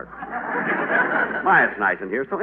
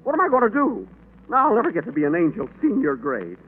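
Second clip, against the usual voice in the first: panicked; 12 semitones higher, 3 dB louder than usual. Acted. Angel loses horn, panics.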